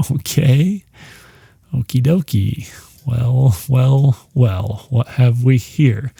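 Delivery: a slow, sly "well, well, well" from a lurking captor; creepy kidnapper voice. Retold or imitated. Kidnapper taunts his chained captive, slow and sly. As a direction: play the captor savoring his catch, lightly voiced.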